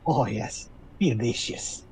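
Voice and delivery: low, soft hag relish; wide-grinning hag. Acted. Hag relishing talk of long pork, softly grinning. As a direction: savour it quietly through a too-wide smile. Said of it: "her too wide smile gets a little wider".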